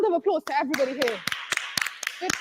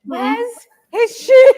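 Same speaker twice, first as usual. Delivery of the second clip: loud; 5 semitones higher, 11 dB louder, much slower than usual. Real, not acted. Host cries out at guest's entrance.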